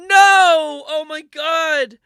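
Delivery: high, loud drawn-out wail; mock horror. Comic acts out hearing granny is dead, mock horror. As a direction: scream it in hammed-up anguish.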